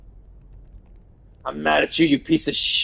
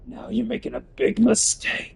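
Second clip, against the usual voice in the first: same threat pitched way up; high cartoon threat; high take; 9 semitones higher than usual. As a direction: squeak the warning up high. Repeat pitched up.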